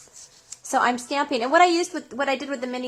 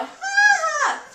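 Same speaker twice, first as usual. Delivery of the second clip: mock laugh; 6 dB louder than usual. Mocking crowd laugh.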